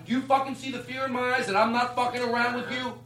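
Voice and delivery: high, slow, menacing bouncer voice; mean bouncer. Pretend. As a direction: threaten like an unhinged bouncer. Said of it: "you have to be the dick".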